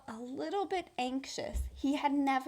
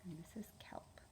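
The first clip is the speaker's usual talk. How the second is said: whisper; 4 semitones lower, 20 dB quieter than usual. Real, not acted. Teacher whispering to the class while showing the picture. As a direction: whisper it like a secret. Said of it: "whispered aside showing picture".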